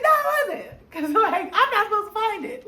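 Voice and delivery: high, loud, laughing no; laughing retort. Retold or imitated. Telling her roommate off, high laughing retort. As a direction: shoot her excuse down, half laughing.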